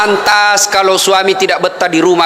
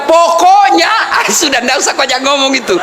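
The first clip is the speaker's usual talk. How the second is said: mock nagging wife; 5 semitones higher than usual. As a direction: slam the door on the argument like an imitated scolding wife. Nagging wife cuts off argument.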